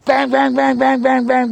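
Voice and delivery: high, loud banging bang bang; loud forceful knock imitation; imitated police knocking. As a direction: pound it out like cops at the door. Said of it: "The police are like".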